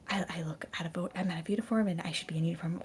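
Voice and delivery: fast, anxious whisper to herself; panicked whisper about the missing uniform; frantic whisper. Acted. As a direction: hiss the worry under your breath. Kebeth frets under her breath.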